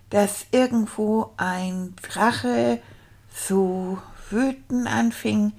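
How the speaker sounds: slow, stuttered sounding-out of words; halting beginner reading. Acted. Beginner reading a dragon story aloud, halting and laboured. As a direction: read haltingly, stretching out difficult syllables.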